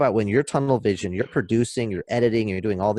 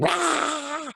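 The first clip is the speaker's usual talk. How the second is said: high shrieking monster roar; be the demon monster for one shriek; hammed-up demon roar, much higher than speech. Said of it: "this demon monster that's just like".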